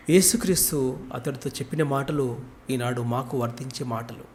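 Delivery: soft, low closing prayer voice. Acted. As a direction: pray humbly and quietly. (మృదువైన ప్రార్థన స్వరం)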